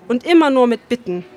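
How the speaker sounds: ordinary delivery, nothing marked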